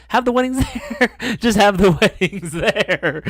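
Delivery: high, laughing through the words; can't keep a straight face. Real, not acted. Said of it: "I can't even say that with a straight face".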